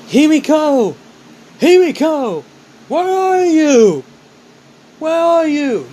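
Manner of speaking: high, loud, long-drawn calls into the dark; calling out. Acted. Ryu searching the underworld for Himiko, calling out loudly. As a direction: shout her name across the empty underworld. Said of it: "try to call out her name".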